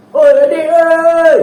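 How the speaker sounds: high, loud call to a sibling; shouting across the house. Shown as a demonstration. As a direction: yell for the kid from another room. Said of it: "jerit-jerit sampai jiran sebelah dengar".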